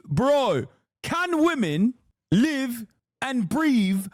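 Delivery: high, drawn-out London bro rant; mock a worked-up London podcaster, stretching words; aggro London accent. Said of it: "London podcasts. They're hilarious in how aggro they are".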